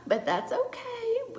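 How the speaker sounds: high, slow words through a laugh. Real, not acted. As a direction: laugh it off while talking. Laughs at her broken blush.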